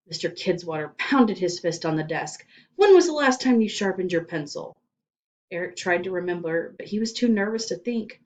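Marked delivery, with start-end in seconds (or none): 2.7-4.8 loud, barked question; loud interrogation. Acted. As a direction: slam the question at him. Principal interrogates.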